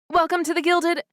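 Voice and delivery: high, loud excited greeting; excited clerk. Acted. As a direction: gush the welcome like a starstruck clerk.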